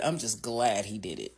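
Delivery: normal talking voice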